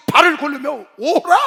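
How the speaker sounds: loud, high, drawn-out proclamation; crying out scripture. Retold or imitated. Preacher acting God's command to cry out to Israel, loud shout. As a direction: belt out the command like a prophet. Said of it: "이스라엘 족속아 하고 외치라는 거야".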